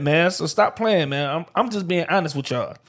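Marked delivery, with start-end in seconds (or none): none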